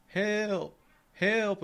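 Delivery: high imitated call, moderately loud; retold cry for help. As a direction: mimic a faraway plea from the woods. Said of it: "start hearing".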